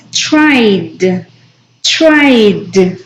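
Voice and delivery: "Tried" ending in a voiced D, said as "traid". Shown as a demonstration. Teacher repeating "Tried" for the class to copy. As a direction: finish "Tried" on a clear D. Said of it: "Vamos a pronunciarlo con D".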